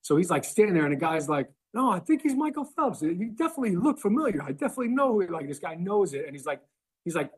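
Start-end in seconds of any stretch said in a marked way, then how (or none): 1.7-6.2 much higher, sure of himself, pushy fan; a fan who won't drop it; insistent customer voice. Retold or imitated. Customer insisting to Eli he's Phelps, high eager voice.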